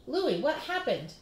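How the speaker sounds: alarmed at her son's state; gasped. Acted. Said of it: "his mother gasped".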